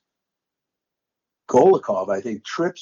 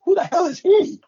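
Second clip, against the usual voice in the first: stunned disbelief. Retold or imitated. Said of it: "What I don't say is".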